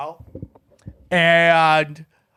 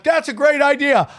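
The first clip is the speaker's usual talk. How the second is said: higher-pitched eager agreement; retold enthusiasm; 7 semitones higher than usual. Teller replays agreeing to the stage contest, eagerly. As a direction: jump on the idea, pitched up.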